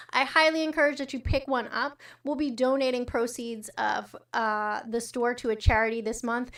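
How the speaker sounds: normal talking voice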